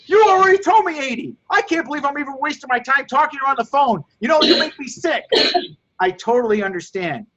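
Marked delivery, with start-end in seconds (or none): 0.1-5.2 fed-up customer. Acted. Role-played fed-up customer.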